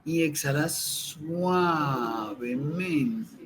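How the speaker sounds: slow, soft meditation voice with long pauses; guided meditation. Acted. Teacher guiding listeners through breathing, calm meditation voice. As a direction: breathe the words out, calm and unhurried.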